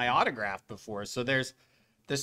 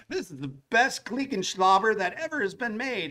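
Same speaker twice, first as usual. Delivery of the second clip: mock salesman pitch; 8 semitones higher than usual. Mock sales pitch at trade booth.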